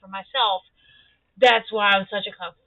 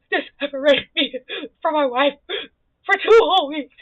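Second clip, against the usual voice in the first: hammed-up whiny complaint, rising louder to the end; mocking crying man; 4 semitones higher, 6 dB louder than usual. Mocking a man who cries on TV over two weeks apart, hammed-up. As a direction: make fun of a grown man whining on TV. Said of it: "somebody who was like".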